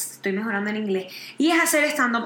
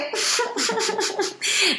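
high awkward giggle; fake polite laugh; 7 semitones higher than usual. Shown as a demonstration. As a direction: laugh along, uncomfortable. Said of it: "¿Cómo te ríes?".

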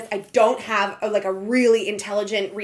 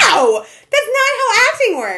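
laughing mock yell, higher and louder; laughing protest, shouted; exasperated shout; 12 semitones higher, 7 dB louder, slower than usual. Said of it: "I'm like".

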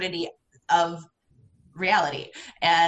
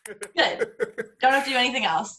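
laughing; 4 semitones higher than usual. Retold or imitated. Self-satisfied joke about violin.

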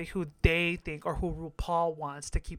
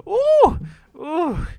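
a much higher, louder squeal of delight; excited ooh; 10 semitones higher, 9 dB louder than usual. Real, not acted. Drooling over Bruno.

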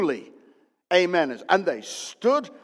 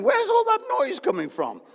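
high, startled question over the noise; surprised shout. Retold or imitated. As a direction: act baffled by the racket. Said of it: "And I'm saying".

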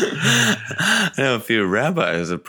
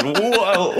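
a sudden, higher startled whoa; startled as the bucket tips; surprised whoa; 10 semitones higher than usual. Retold or imitated. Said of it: "and he goes".

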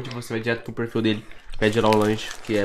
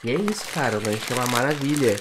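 ordinary talk

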